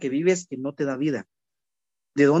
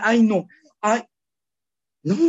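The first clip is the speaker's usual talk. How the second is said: higher, whiny fretting sighs; mock fretting; 5 semitones higher than usual. Mocking adults fretting over prices, whiny and higher. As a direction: fuss like a worried grown-up. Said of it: "Y ahora".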